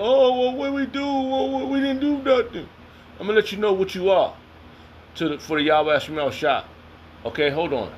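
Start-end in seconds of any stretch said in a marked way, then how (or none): 0.0-2.7 high mock-innocent voice of the accused; mock innocence. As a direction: play dumb in a high protesting voice. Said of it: "Ain't nobody go".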